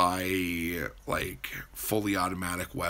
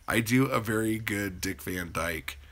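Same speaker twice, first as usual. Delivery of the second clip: ordinary talk, nothing marked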